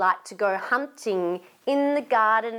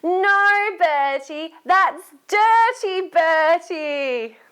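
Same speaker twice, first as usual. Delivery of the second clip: high, loud, drawn-out telling-off; scolding; 6 semitones higher, 5 dB louder, much slower than usual. Acted. Storyteller leading children to tell Bertie off, scolding. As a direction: wag a finger and scold in singsong. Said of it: "you can do it better than that".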